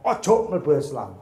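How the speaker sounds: loud, forceful prohibition; sudden loud objection; urgent inner voice. Retold or imitated. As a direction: burst out with a loud warning. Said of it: "Nafsu saya ngomong".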